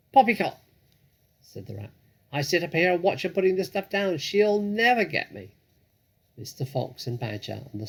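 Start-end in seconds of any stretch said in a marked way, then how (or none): 0.1-5.5 high, loud squeaky scoff; scoffing rat. Acted. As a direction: sneer it like a cocky rat.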